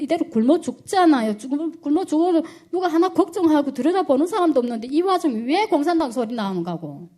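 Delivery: retold angry protest to a father, loud and high; angry outburst. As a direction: relive a fed-up, desperate blow-up at your father. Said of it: "제가 너무 화가 난 거예요".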